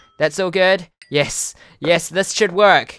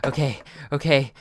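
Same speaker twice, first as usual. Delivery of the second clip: ordinary talk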